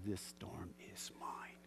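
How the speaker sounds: whispered and slow, low and intense; preacher whispering a vow against the storm; defiant whisper. Real, not acted. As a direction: claim it quietly, eye to eye with the storm. Defiant words to the rain.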